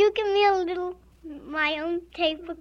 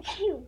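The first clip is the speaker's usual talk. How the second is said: fake sneeze; 8 dB quieter than usual. Child fakes sneezes, mother objects.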